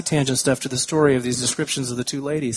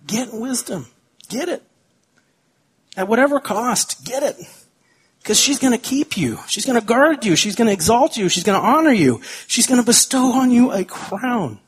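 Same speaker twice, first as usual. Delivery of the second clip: urgent exhortation; 7 semitones higher than usual. Real, not acted. Pastor exhorts congregation urgently.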